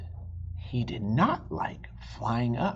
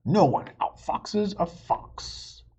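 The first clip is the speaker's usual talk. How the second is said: proud fox vow; 5 semitones higher, 4 dB louder than usual. Acted. Fox's vow continues.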